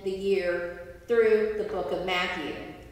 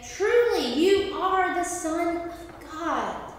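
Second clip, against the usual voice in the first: awed proclamation; 5 semitones higher, slower than usual. Acted. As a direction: proclaim it like awestruck disciples. Disciples worship Jesus in awe.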